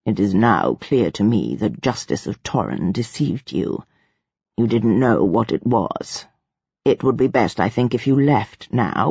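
deep, measured, formal dismissal; low-voiced figure of authority; imperious ruler. A narrator's reading. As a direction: dismiss them politely in a low, controlled voice.

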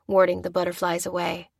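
plain reading voice

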